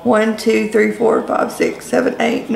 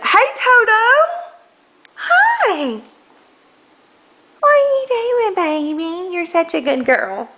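pet talk; 10 semitones higher than usual. Real, not acted. Greeting the family dog.

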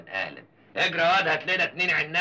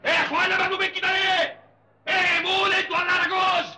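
angry shouting; 13 semitones higher than usual. Acted. Father stopping his sons' fight and scattering the crowd, angry. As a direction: bark orders to break it up.

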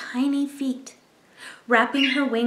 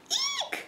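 very high startled eek; startled squeal at the hanging chicks; mother bird's shriek; 8 dB quieter than usual. Acted. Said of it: "she cried".

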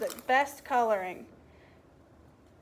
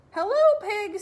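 higher, louder chirpy duck greeting; cheery duck; 8 semitones higher, 7 dB louder than usual. Acted. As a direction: call out hello like a happy duck.

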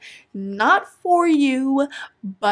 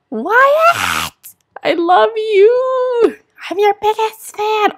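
high, slow, crying declaration of love; teary gushing; 11 semitones higher, 3 dB louder, much slower than usual. Real, not acted. Vlogger crying over her sim graduate, high sobbing. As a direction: sob out your love for him. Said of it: "I'm actually tearing up a bit".

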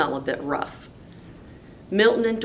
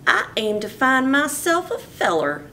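higher Texas gal voice; a lonesome ranch gal, a touch higher; Miss Lurleen; 8 semitones higher than usual. Acted. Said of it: "she said".

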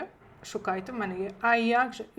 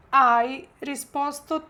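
slow model phrase; 3 semitones higher, slower than usual. Shown as a demonstration. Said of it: "відмінюємо наше дієслово avere".

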